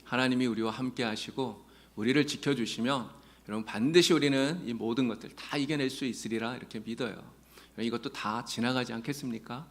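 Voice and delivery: ordinary talk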